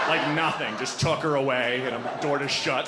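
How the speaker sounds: usual speaking voice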